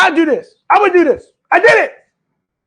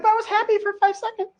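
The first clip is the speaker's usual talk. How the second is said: high, hammed-up whiny protest; mocking high plea; mock whine; 8 semitones higher, 9 dB quieter, faster than usual. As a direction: whine it like a kid caught out. Mocking self-justifying listener.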